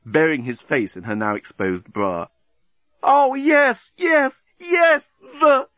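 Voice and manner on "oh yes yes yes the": high, loud ecstatic shrieks; screaming. A narrator's reading. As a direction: shriek each yes louder and higher. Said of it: "she screamed".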